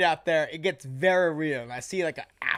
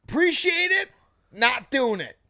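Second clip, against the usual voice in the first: mock polite refusal; 7 semitones higher, 3 dB louder than usual. Retold refusing the shot.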